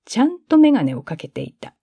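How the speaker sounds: plain reading voice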